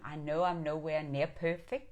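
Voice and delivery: ordinary talk, nothing marked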